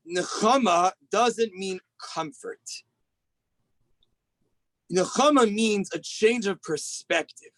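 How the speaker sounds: very slow, each word weighed; a preacher slowing for the main point; slow emphatic teaching. Acted. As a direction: lay down the key idea slowly. Key teaching, slowed for emphasis.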